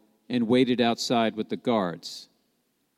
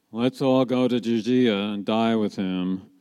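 slow, resigned monotone; gloomy pessimist. Acted. Doubting disciple proposing to go and die with him, gloomy. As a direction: say it as a glum fatalist. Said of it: "look on the less than bright side of things".

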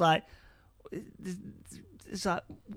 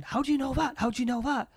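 higher-pitched mock-frantic questioning; mildly hysterical; 7 semitones higher, 4 dB louder, faster than usual. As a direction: imitate a captain getting worked up. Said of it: "a little bit more hysterical".

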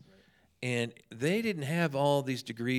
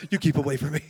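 louder, laughing through the words; laughing repeat; 3 semitones higher, 9 dB louder, faster than usual. Retold or imitated. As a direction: crack up as you repeat it.

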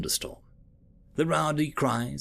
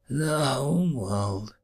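slow, hushed farewell; 2 semitones higher, much slower than usual. Acted. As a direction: let each word hang like a last goodbye. Final farewell to Earth.

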